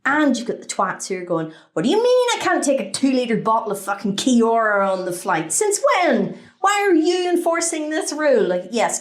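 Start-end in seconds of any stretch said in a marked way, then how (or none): 1.7-8.4 high, affronted demanding; indignant passenger. Acted. Passenger arguing over a bottle at security, indignant. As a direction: challenge the rule with mounting outrage.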